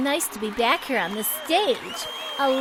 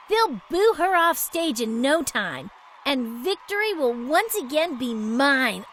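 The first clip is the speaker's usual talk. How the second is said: usual speaking voice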